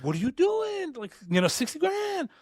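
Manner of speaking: very high incredulous wife voice; high-pitched mock spousal disbelief; imitated wife. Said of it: "And my wife is like".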